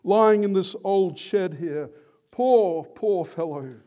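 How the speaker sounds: loud, high, drawn-out lament; swelling pity that falls away on the last words; melodramatic pity. Acted. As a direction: grieve over a dying friend, hammed up. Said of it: "It's a bit melodramatic".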